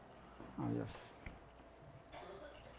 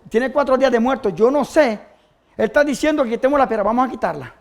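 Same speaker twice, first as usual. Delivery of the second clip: ordinary talk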